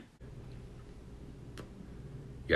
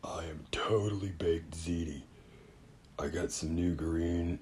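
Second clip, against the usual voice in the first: stoned whisper; 3 dB quieter than usual. Acted.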